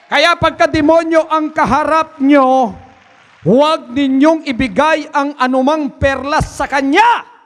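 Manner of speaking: fiery preaching. Acted. Preacher shouting a warning to the congregation, fiery preaching. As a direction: thunder the warning at the flock.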